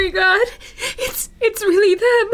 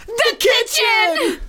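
alarmed shout; 6 semitones higher, much slower than usual. Acted. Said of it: "They look at each other and say".

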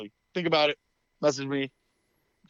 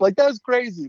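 high loud outraged cry; mock outraged; 11 semitones higher, 5 dB louder than usual. Venting to eBay about a nutty buyer, high outraged. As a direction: sound fed up and worked up. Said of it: "call eBay this week and be like".

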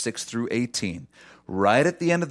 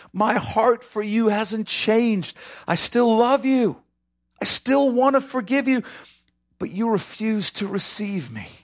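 pleading lament; 10 semitones higher than usual. Acted. Jesus's lament voiced in sermon.

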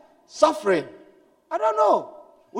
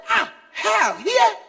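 high, loud, shocked «hell, here?»; a startled shout on finding a friend in hell; shocked cry; 10 semitones higher, 4 dB louder, much slower than usual. Retold or imitated. Shocked question in the dream.

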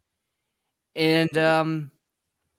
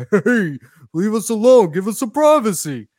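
higher, louder mock yell through a door; mock motel guest shout; 7 semitones higher, 9 dB louder than usual. Motel guest yelling at the sheriff, hammed-up and loud. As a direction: bark it like an annoyed man behind a door. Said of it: "you hear a man say".

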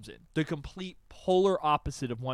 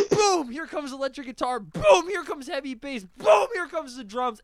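high, loud booms punching each entrance; explosive hype; 8 semitones higher, 5 dB louder than usual. Retold or imitated. Host acting out the song ramping up, excited booming delivery. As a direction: announce each instrument like a blast. Acts out instruments crashing in.

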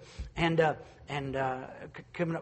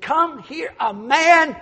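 slow, high, loud call to the town; excited shout; 11 semitones higher, 12 dB louder, much slower than usual. Acted. Woman calling her old crowd, excited shout. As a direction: call out to everyone to come. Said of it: "she said".

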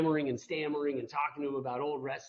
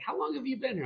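boxing owner's question; 11 semitones higher than usual. Retold or imitated. Boxing gym owner voiced.

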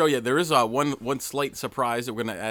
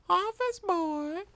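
ghostly voice; much slower than usual. Acted. Ghost voice on EVP recording.

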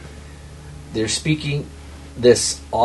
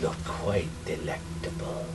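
watery creature voice; 5 semitones lower, 12 dB quieter than usual. Acted. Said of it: "watery voice".

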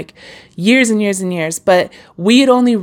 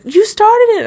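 much higher, louder startled question; surprised friend; 12 semitones higher, 4 dB louder than usual. Retold or imitated. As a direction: gasp it out in disbelief. Friend learns of podcast.

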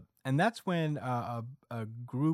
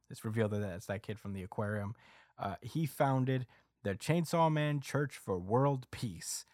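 normal talking voice

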